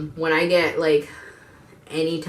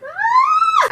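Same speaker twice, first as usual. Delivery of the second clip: sharp yelp; 10 dB louder than usual. Real, not acted. Outburst opening the video.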